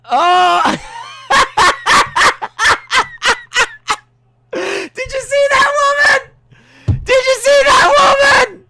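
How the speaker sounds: high, loud laughing disbelief; shrieking with delight at the replay. Real, not acted. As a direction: squeal it out in delight. Delighted shouting about the woman.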